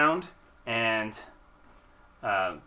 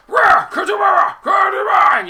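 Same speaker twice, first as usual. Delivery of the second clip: huge roar, slow and loud; bugbear roar; 15 dB louder than usual. Acted. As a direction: roar it out like a monster. DM voices bugbear roaring.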